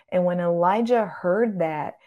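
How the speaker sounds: ordinary delivery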